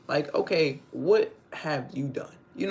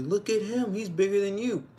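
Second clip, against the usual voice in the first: pitched up high, imitating the woman; mock female voice; 8 semitones higher than usual. Retelling the woman mocking her man, voice pitched up. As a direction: lightly put on a higher woman's voice. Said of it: "the female was like".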